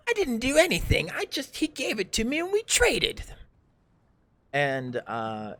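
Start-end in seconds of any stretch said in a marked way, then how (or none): 0.1-3.2 high-pitched defensive character voice; loud, high villain making excuses; villain protesting. Acted. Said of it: "he looks to me and goes".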